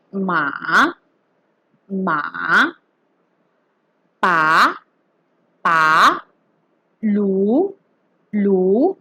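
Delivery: long rising glide on each "ma, pa, lu"; rising second tone. Shown as a demonstration. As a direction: draw "ma", "pa", "lu" upward like questions. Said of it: "also known as the rising tone".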